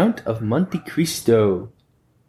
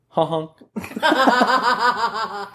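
high honk honk through laughter; can't hold the laugh; 12 semitones higher than usual. Acted. Repeat while cracking up.

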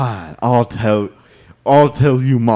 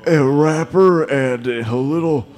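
higher, halting mock prayer with long pauses; deacon's prayer; 4 semitones higher than usual. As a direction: pray haltingly over the couple. Deacon leads a wedding prayer.

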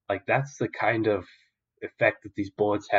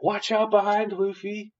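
urgent warning cry; 12 semitones higher than usual. Retold or imitated. Boy warning Luffy about the attack from behind, high shout. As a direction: scream a warning to a friend in danger.

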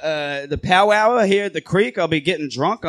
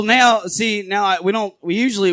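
usual speaking voice

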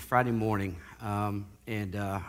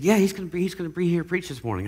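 higher, louder eager announcement; excited buddy; 9 semitones higher, 5 dB louder, faster than usual. Retold or imitated. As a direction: relay the big news with eager excitement. Buddy voiced announcing the preacher.

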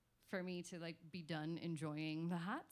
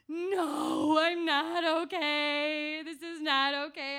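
loud, high sobbing plea, voice cracking; crying to mom; 9 semitones higher, 13 dB louder, slower than usual. Retold or imitated. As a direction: wail it through tears, much higher and louder. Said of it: "I cried to my mom".

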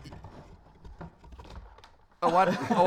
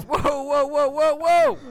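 rising, higher mock-alarmed whoas; alarmed protest; 7 semitones higher, 5 dB louder, slower than usual. Prospector balks at the tavern owner's offer, mock-alarmed. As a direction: stop him in hammed-up alarm.

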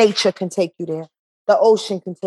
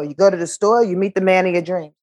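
ordinary talk, nothing marked

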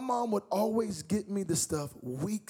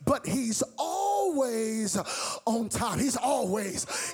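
preaching; 6 semitones higher, slower than usual. Acted. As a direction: stretch every word to the congregation. Preaching climax.

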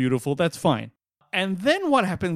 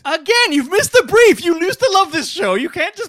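high, loud protest at a friend; exasperated; 12 semitones higher, 7 dB louder than usual. Real, not acted. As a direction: scold him for cheating the list. Exasperated telling-off of a co-host.

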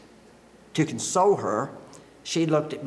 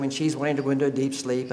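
ordinary talk

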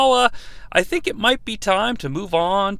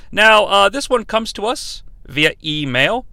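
ordinary talk, nothing marked

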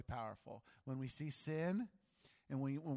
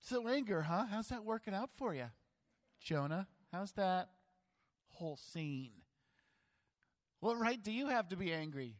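mocking, raised, louder jabs; God teasing an angry prophet, sarcastic and loud; 5 semitones higher, 4 dB louder than usual. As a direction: needle him with sarcasm. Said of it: "Other ways to say this?".

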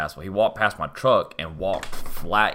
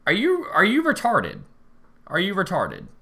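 higher, incredulous jab; exasperated insult; 10 semitones higher than usual. Retold or imitated. Teller exasperated with the stranger, incredulous jab. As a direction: throw it at him in disbelief.

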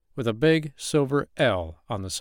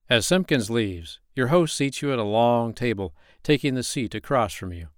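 ordinary delivery, nothing marked

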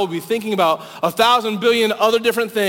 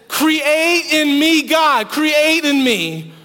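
David begging God, slow and raised; pleading cry; 6 semitones higher, much slower than usual. Retold or imitated. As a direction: stretch each word like a desperate prayer. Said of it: "this cry to God".

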